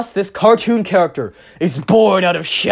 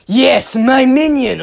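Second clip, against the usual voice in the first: louder, slow, commanding villain incantation; grand villain summons; 6 semitones higher, 5 dB louder, slower than usual. Acted. Noir Rabbit calling his new minion, in a slow grand villain voice. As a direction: draw it out like a dark sorcerer. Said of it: "So, Noir Rabbit's like".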